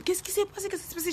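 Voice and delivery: high-pitched rapid questioning; imitating alarmed friends. Friends wanting to know what happened, high mock alarm. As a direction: mimic friends asking in a high voice.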